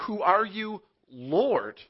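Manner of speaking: high, loud, frightened cry of Saul; scared Saul. Acted. Saul thrown from his horse, crying out to the sky, afraid. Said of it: "Saul's pretty scared".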